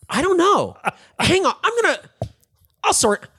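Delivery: high, loud, flustered mock innocence; playing dumb. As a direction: stall in exaggerated bewilderment. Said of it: "never actually lied or denied it".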